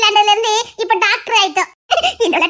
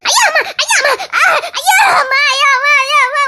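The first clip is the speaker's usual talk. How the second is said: mock pain cries; 4 semitones higher than usual. Mother cries out in faked agony to fool the girls. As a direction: cry out as if in agony, half laughing.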